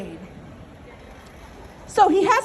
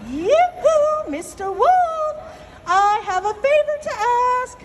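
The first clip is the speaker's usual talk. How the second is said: rabbit calling out; 7 semitones higher than usual. Acted. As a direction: call yoo-hoo sweetly in a high rabbit voice. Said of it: "So he asks".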